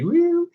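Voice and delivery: high-pitched mock crying "woo"; cartoonish high wail; imitated crying wail. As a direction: let out a playful, high crying sound. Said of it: "crying intensely, like".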